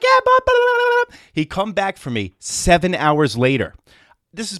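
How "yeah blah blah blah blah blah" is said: much higher and much louder, manic squeal; John the wired boss. Retold or imitated. Coked-up landscaping boss greeting the twelve-year-old, shrieking high and loud. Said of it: "So he'd be like".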